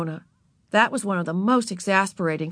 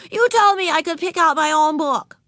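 put-on little girl voice; 10 semitones higher, 4 dB louder than usual. A narrator's reading. Narrator voicing Ramona to Beezus, high and childlike. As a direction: play four-year-old Ramona, proud.

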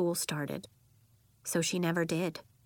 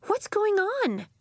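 put-on high, drawn-out question as persistent girl; insistent young Nori, higher and slower; persistent child; 4 dB louder than usual. A narrator's reading. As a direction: press for an answer, pitched high. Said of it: "Nori persisted".

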